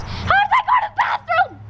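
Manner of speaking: very high-pitched shouted question; squawking, incredulous shout; baffled outburst. Acted. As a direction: yell it in comic disbelief. Baffled outburst at a plot hole.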